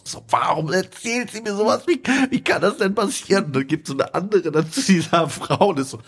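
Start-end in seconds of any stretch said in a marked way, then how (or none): 0.3-3.5 high, imitated distraught outburst; freaking out. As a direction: mimic a woman losing it. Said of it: "rastet halt komplett aus".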